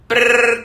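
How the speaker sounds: loud rolled phone trill; imitated phone ring. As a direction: buzz like a ringing phone. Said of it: "tocou de novo". (imitando o telefone tocando)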